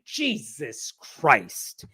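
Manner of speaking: drawn-out, frustrated muttered oath; slow, annoyed mock curse; exasperated swearing. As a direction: imitate an exasperated kid, stretching both words slowly. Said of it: "you don't use that tone".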